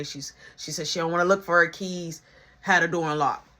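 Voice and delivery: ordinary talk, nothing marked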